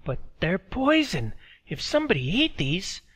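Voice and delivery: Katy startled and warning; girl alarmed at the old woman; young Katy. Acted. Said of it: "Katy looked at her".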